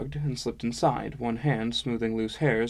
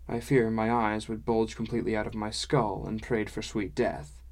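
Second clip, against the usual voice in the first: ordinary delivery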